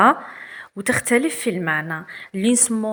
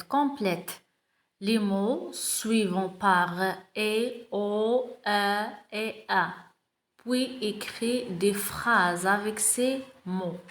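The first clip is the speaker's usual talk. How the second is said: slow reading aloud; 4 dB quieter, much slower than usual. Shown as a demonstration. Teacher reading the text aloud to pupils, very slow. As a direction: read each word very slowly. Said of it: "On va commencer".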